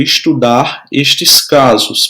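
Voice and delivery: estudar estes casos, slowly, word by word; teacher dictating slowly to students; slow dictation. Shown as a demonstration. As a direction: dictate it slowly so they can write. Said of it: "Escreva aí no seu livro".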